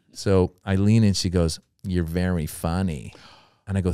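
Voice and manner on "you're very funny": low, slow, confidential mob-boss delivery; mafia boss. Retold or imitated. Club owner pulls comedian close, mafia-boss hush. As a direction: lean in like a quiet godfather. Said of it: "it's like a mafia situation".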